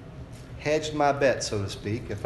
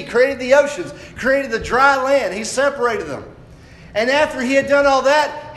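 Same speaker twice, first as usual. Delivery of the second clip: loud, high-pitched sermon delivery; full-force preaching; 10 semitones higher, 8 dB louder than usual. Real, not acted. Preacher telling congregation about God's rest from creation, full-force preaching. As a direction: preach it out, building in pitch and volume.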